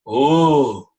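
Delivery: loud open oh from the chest; sound from inside. Shown as a demonstration. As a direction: let a deep oh ring out from inside. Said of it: "listen to how I make this sound inside myself".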